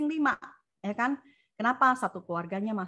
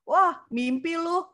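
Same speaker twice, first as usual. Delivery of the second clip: slow, drawn-out mocking "Wah, mimpi lu", pitched higher; mocking scoff; 7 semitones higher, 4 dB louder, much slower than usual. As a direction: scoff at the dream, stretching it out slowly. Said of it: "Orang ngomong".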